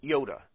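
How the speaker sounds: iota with a light, quick E, yota; don't give the E much weight in iota. Shown as a demonstration. Teacher models iota with a light E. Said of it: "you don't give the E that much impact".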